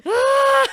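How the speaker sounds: hammed-up high "ah"; high loud scream. Singer shows her reaction to filming herself performing, hammed-up high cry. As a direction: belt out a mock panicked wail. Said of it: "I'm just like".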